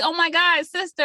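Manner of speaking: light up at seeing her; mock excited greeting. Friend voicing the hero greeting his sister, excited.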